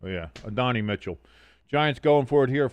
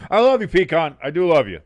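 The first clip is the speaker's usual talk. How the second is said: high, loud playful declaration; loud playful love to a chatter; loud mock love; 5 semitones higher, 8 dB louder than usual. Real, not acted. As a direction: shout your love to the chatter. Affection to chatter Pecan.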